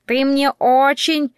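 high, slow squawking parrot voice; parrot mimicry. A narrator's reading. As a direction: parrot it back, stretching the words out. Parrot repeats her confession.

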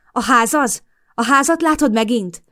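worried woman pressing a frightened man; urgent. A narrator's reading. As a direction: press him anxiously. Narrator urgently questions panicking Norman.

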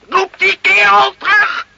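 roaring command, slow and high; furious order. Acted. Lieutenant bellows at sergeant to call Carlo back, furious.

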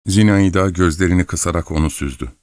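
usual speaking voice

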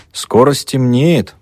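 meaningful. A narrator's reading. Mavra hinting to the student about nightfall, meaningful. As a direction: draw it out with significance. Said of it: "многозначительно сказала Мавра".

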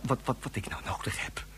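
urgent hushed whisper; secret whisper. Acted. Inspector secretly whispering his request down the phone. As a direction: keep it hidden from the madman.

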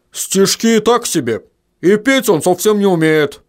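ordinary delivery

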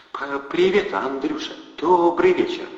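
shy fat guy mumbling hello; timid stammer. A narrator's reading. Timid Eddie greets Andrey, stammering nervously.

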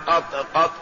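"قط قط" ending on a vowelless ط; sukun ending. Shown as a demonstration. Teacher showing the first variant of the word, sukun ending. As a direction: stop dead on the ط in "قط قط", no vowel after it. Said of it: "بالسكون".

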